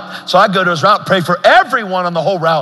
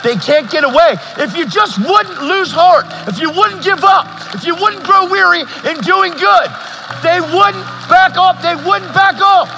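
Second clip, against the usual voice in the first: preaching at full force; 13 semitones higher than usual. Real, not acted. Preacher urging the crowd not to lose heart, impassioned and high. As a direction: plead with them not to give up, climbing high.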